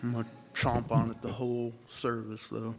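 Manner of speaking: talking while chewing gum. Real, not acted. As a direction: gum in the mouth, chomping as he talks. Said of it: "with gum in my mouth".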